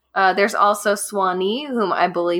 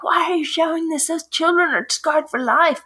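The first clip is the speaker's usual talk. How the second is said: angry viewer; 10 semitones higher than usual. Retold or imitated. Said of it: "we got so many angry phone calls".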